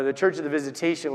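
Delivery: ordinary talk, nothing marked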